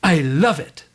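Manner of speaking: imitating an instant, delighted answer; brightened retold reply; delighted, no hesitation. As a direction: answer at once as if lighting up. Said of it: "Her face lit up and she said".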